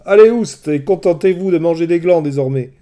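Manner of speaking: high, loud shout; loud, mocking command. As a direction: chase the pigs out with a shout. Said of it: "Circé leur cria".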